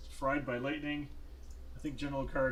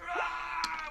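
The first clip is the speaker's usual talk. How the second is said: high scream of a dying general; acted death scream. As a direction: let out a long, high death cry. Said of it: "so he kinda goes".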